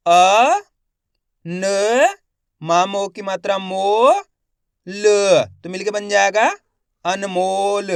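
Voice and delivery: slow spelling drill, each syllable held with a rising end; stretched syllables for beginning readers; letter-by-letter sounding out. Shown as a demonstration. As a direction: sound out each letter, then blend the word. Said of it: "एक एक अक्षर का उच्चारण".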